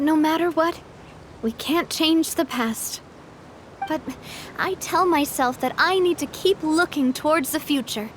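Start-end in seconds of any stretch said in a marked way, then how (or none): none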